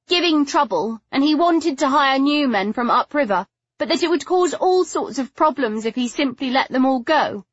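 high-pitched, earnest justification; insistent. A narrator's reading. Daughter defending her father's plan to Stoker, insistent. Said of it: "she insisted".

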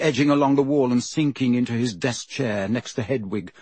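ordinary delivery

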